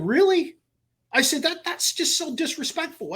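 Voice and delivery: high, indignant protest. Retold or imitated. To the guard at the line, high indignant protest. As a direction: let the outrage rise. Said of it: "I'm like".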